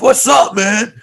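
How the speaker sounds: loud, high imitation of a friend; hollered mock greeting. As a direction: holler it like a salesman hailing a buddy. Acts out salesman friends calling out.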